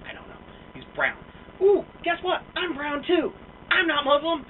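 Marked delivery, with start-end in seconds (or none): 1.6-4.4 high, loud fake-surprised taunt; sarcastic gotcha, pitched up; mock surprise. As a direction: sing it out in mock astonishment.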